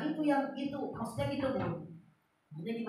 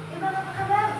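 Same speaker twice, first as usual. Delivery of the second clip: cheerful greeting; 8 semitones higher, 6 dB louder, faster than usual. Retold or imitated.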